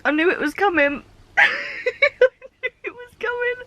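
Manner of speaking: high, teary laugh-cry; half giggle, half sob; laughing through tears. Real, not acted. Crying and laughing at ending.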